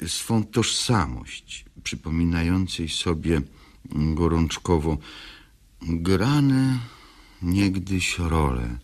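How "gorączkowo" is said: ordinary talk, nothing marked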